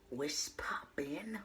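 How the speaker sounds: slow playful whisper. Acted. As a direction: drag it out in a whisper. Greeting whispered slowly.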